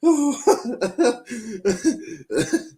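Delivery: high, long burst of laughter; prolonged high-pitched laugh; helpless laughter. Real, not acted. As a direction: crack up laughing, voice way up. Cracking up at the argument.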